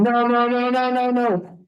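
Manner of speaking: high, frantic mock yell of no; a coach yelling at his runner to stop, high panicked cries; retold screaming. Coach shouting no at a kid stealing home, mock frantic yell. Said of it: "and I'm screaming".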